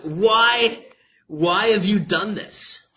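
loud, high, slow demand; emphatic questioning. Acted. God confronts disobedient people, emphatic demand. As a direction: draw out the question at full voice. Preacher voices God's question from verse.

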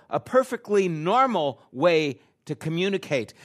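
ordinary talk, nothing marked

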